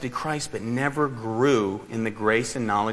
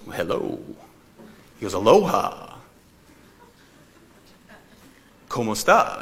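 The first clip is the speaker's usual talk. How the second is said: mocking prank caller. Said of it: "he was just kinda mocking me".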